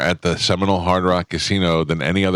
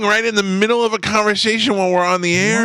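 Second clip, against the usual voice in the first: high exasperated disbelief; incredulous; 13 semitones higher than usual. Real, not acted. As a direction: call him out, half laughing. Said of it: "reacts to co-host eating on air".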